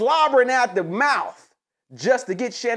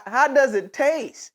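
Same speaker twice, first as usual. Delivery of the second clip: ordinary talk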